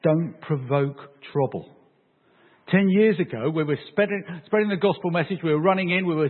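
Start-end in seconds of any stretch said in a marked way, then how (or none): 0.0-1.8 slow, separated words; measured, emphatic caution; deliberate warning. Acted. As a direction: warn them one word at a time. Paul's warning, slowly voiced.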